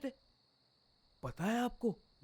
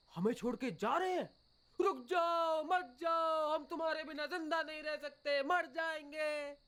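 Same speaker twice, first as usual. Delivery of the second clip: mock pleading; 11 semitones higher than usual. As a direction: replay the desperate dream plea. Retelling the dream's begging.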